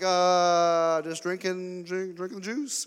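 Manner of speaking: mock sheepish excuse. Kid caught by dad, making excuse.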